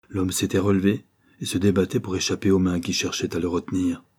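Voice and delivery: plain reading voice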